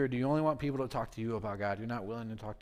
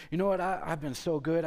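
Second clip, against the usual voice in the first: higher and louder, puffed-up pride; boastful Haman; 5 semitones higher, 5 dB louder than usual. Acted. Haman boasts to himself after the feast, proud and louder. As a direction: announce it like a man full of himself.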